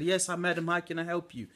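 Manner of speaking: mock shout back to the old lady; retold shout. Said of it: "but I still shouted".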